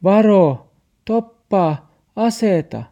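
high, loud call; warning shout. A narrator's reading. Olli yells to warn Max, high loud shout. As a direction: cry out a warning word by word. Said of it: "Olli huusi".

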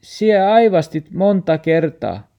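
dog voice, high and loud, reproachful; dog's complaint. Acted. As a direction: remind her sadly of the sneezing. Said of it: "Max sanoi".

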